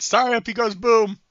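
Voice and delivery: high, loud warning shout; yelling out. Acted. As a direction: call it out loudly to a teammate across a battlefield. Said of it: "And I yell out at Forsyth".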